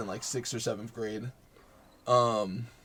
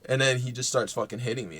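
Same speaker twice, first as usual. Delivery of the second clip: usual speaking voice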